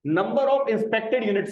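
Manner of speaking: the name drawn out very slowly for notes; teacher dictating a working's name; slow dictation. Shown as a demonstration. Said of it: "इस working का नाम लिखें".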